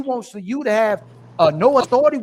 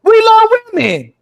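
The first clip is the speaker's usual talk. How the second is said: raised voice, arguing hard; heated protest; 4 semitones higher, 6 dB louder than usual. Real, not acted. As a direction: push back loudly and higher in pitch. Heated argument, protesting an accusation.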